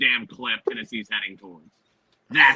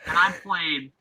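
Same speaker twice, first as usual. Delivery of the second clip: ordinary delivery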